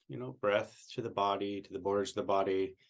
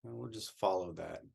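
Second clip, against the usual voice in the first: soft, soothing meditation voice; guided meditation; 2 semitones lower, 7 dB quieter than usual. Real, not acted. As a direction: guide listeners gently into relaxation, leaving long pauses.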